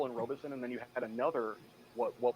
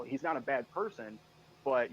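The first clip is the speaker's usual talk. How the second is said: normal talking voice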